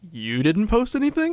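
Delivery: high disbelieving question; incredulous. Acted. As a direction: toss it back in mock shock. Turning question back.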